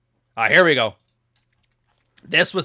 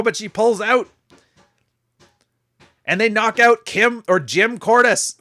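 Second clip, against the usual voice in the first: higher, louder wrestling call; play-by-play; 7 semitones higher, 5 dB louder than usual. Pretend. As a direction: call the match live, building excitement. Live play-by-play of imagined match.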